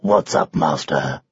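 very low, husky lion; lion's voice. Acted. Lion asks why the knight stopped, low and husky. Lion questions his master.

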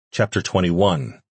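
ordinary delivery

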